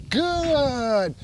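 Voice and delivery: GOOD stretched, high then gliding down; drawn-out duration marker. Real, not acted. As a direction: stretch out the praise word "good". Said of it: "done a nice little long pause".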